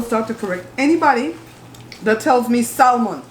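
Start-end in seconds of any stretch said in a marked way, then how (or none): 2.6-3.2 "salmon" with the L sounded; slow "SAL-mun"; mispronounced salmon. Shown as a demonstration. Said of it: "the L is silent".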